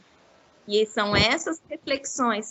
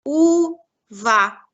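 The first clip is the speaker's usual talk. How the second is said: "Uva" broken into u, pause, va; "Uva" said in two spaced beats for children; syllable by syllable; 4 dB louder than usual. Shown as a demonstration. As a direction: separate the first sound of "Uva" from the rest. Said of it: "Tá no começo ou no final?".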